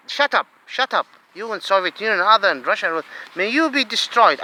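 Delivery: ordinary delivery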